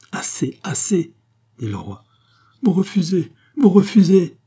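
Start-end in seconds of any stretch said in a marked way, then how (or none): 0.1-1.1 high, slow 'enough' to cut off pleas; firm protest. A narrator's reading. King cuts off the pleading. 2.6-4.4 high, mounting cry of disbelief; aghast outcry. A narrator's reading. Queen aghast at king's refusal.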